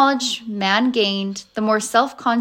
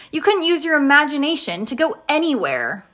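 put-on higher-pitched child's protest; crying out; 5 semitones higher than usual. A narrator's reading. Ben objects to travelling by imagination, crying out. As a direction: object in a raised, indignant pitch. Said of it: "Ben cried".

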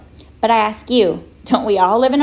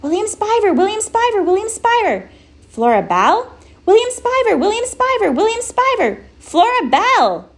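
calling out; 13 semitones higher than usual. Acted. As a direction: call his name up into the dark. Calling out into the night.

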